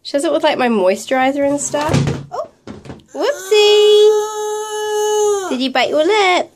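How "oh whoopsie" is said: high, loud, drawn-out mum voice; sing-song whoopsie. Real, not acted. Toddler has a little mishap.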